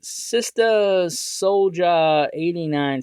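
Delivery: "Sister Soldier eighty-nine" sounded out syllable by syllable; slow careful reading. Shown as a demonstration. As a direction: read the unfamiliar username slowly and carefully. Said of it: "gonna probably butcher this username".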